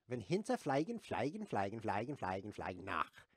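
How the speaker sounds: "fliegen" said as FLY-gen, over and over; mispronounced German. Acted. Streamer reading a German oldie, "fliegen" mispronounced as FLY-gen. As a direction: say each "fliegen" with an English "fly". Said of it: "It's fliegen, though".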